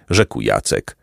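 plain reading voice

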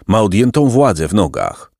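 plain reading voice

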